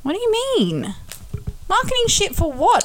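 high, shocked disbelief; surprised. Real, not acted. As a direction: pitch jumps up in shock. Shocked reaction to spy claim.

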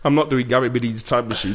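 ordinary talk

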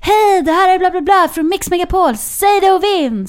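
high, loud radio jingle shout; over-the-top radio station caller; fake radio host. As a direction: belt it out like a radio presenter, stretching the slogan. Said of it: "låtsades att vi var radiostationen".

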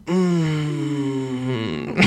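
normal talking voice

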